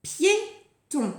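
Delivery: Piéton crisply articulated, falling at the end; word announcement. Shown as a demonstration. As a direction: present "Piéton" clearly with a brief gap. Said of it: "On compte les syllabes ensemble".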